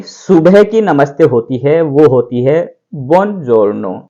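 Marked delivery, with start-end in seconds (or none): 3.1-4.0 बुongiorno said the Italian way, the o barely there; teacher demonstrating the Italian greeting; Italian pronunciation. Shown as a demonstration. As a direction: model बुongiorno cleanly for students. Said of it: "बोलेंगे".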